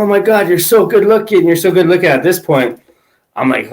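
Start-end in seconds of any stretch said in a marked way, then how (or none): 0.0-2.1 put-on lightly higher, gushing girly voice; imitating a drunk girl. Retelling a drunk girl on his lap flattering him, lightly higher gushing voice. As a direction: mimic her fawning over him, a touch higher. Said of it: "She's like".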